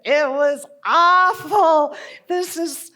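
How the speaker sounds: theatrical outburst of loathing; appalled protest. Acted. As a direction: wail in exaggerated dismay. Hating healing school, acted protest.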